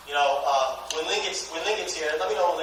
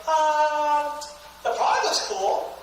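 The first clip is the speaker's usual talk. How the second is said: reluctant positive answer; 7 semitones higher, much slower than usual. Acted. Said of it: "You gotta go".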